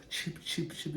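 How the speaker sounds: usual speaking voice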